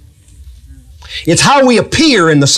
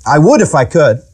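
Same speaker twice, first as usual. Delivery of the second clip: ordinary delivery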